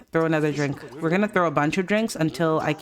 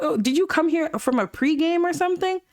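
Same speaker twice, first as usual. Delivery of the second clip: high-pitched incredulous questioning; high, disbelieving scolding; appalled questioning; 9 semitones higher than usual. Real, not acted. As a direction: demand answers, voice pitched way up. Scolding Obi for causing a scene.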